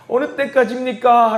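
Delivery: high, slow plea to God; lamenting prayer. Acted. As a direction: cry out slowly in acted lament. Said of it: "하나님께 탄식하면서 기도했던". (탄식하듯 높고 길게 호소하는 말투)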